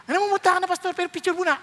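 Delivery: teasing imitation of a pushy fan; fussing churchgoer. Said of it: "Tinapos ng Lord yung preaching".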